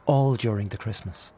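ordinary delivery

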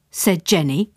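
ordinary delivery, nothing marked

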